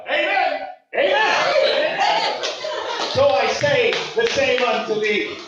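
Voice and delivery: high, rousing amens settling into grand thanks; hammed-up gospel praise, calling out to the crowd; revival-preacher testimony. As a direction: proclaim it like a playful church testimony. Mock testimony, thanking the teacher.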